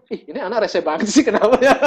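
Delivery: high, loud exasperated "Ih"; irritated at the teasing friend; annoyed retort. Retold or imitated. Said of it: "Saya bilang". (kesal, tinggi dan keras)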